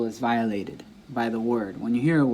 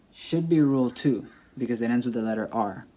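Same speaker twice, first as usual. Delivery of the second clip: ordinary talk, nothing marked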